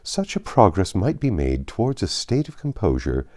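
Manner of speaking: plain reading voice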